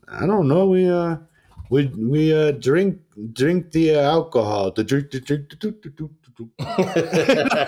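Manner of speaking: mock foreign guy fumbling for words, stammering and repeating; halting broken English. Host imitating Florian's broken English to his co-host, halting. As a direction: play the confused foreigner who can't find the word drink.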